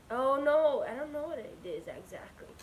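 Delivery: ordinary talk, nothing marked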